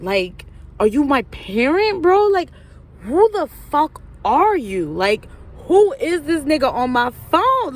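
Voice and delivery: high, loud incredulous outburst; indignant retort. Retold or imitated. Telling off friend's boyfriend for prying, high and indignant. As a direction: go off on him, pitch and volume jumping up. Acted indignant reply to his text.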